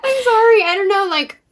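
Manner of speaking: high, loud laughing sorry; laughing apology. Real, not acted. Apologizing to a friend while laughing. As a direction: giggle out an apology.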